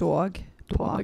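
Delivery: imitating a New Jersey accent. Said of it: "you can tell when I say dog".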